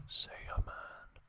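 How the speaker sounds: usual speaking voice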